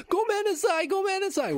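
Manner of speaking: very high, loud imitation of a pupil; frantic apology. Boy yanked out of class apologizes, frantic high-pitched squeal. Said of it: "trying to apologize".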